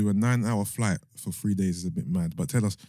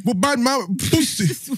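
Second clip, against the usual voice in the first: incredulous; 6 dB louder, slower than usual. Real, not acted. Confused mock repeat.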